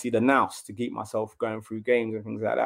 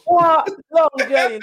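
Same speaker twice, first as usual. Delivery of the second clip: much higher, loud, amazed echo; incredulous what; 7 dB louder, slower than usual. Real, not acted. Reacting to the host's comment, incredulous. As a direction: scoff at the idea in disbelief.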